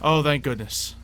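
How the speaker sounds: ordinary talk, nothing marked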